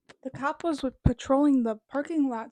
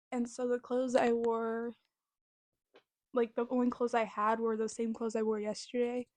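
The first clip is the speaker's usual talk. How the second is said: ordinary delivery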